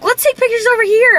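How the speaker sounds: high, quick mimicking voice; high mock voice. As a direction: squeak it out teasingly. Mimicking partner's eager request.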